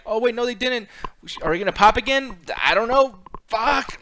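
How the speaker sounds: high, loud baffled outburst; confused crowd. Retold or imitated. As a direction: sound like the crowd caught off guard.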